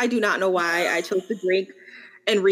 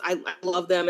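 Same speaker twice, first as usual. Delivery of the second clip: usual speaking voice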